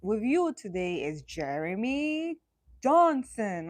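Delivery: slow announcement landing firmly on the surname; weighty, drawn-out host introduction; strong, respect-my-name. Shown as a demonstration. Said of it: "gotta have that strong respect my name".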